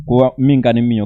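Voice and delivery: usual speaking voice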